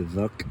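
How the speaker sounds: "Werk" said with a V, as "Verk"; German pronunciation. Shown as a demonstration. Camper explains the German name, "Werk" pronounced "Verk". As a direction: start "Werk" on a German V sound. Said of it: "it's German, so you gotta say".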